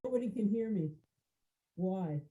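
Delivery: ordinary delivery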